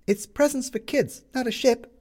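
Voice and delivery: put-on high, puzzled young boy; boy asking, voiced high. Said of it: "said the boy".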